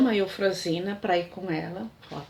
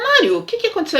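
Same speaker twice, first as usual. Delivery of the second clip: higher and louder, alarmed; alarmed question; 8 semitones higher, 8 dB louder, faster than usual. Retold or imitated. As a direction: ask it suddenly worried, voice jumping up. Alice confronts Mário leaving.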